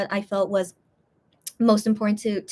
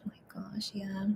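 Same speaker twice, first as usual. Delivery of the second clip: whispering; 18 dB quieter than usual. Real, not acted. Feeling unwell, sighing.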